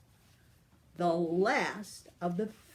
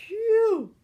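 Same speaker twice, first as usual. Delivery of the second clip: much higher, louder mock phew; phew of relief; 14 semitones higher, 11 dB louder than usual. Grandma tells the children they are safe, loud high phew. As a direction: blow out a big relieved phew. Relief after escaping spell.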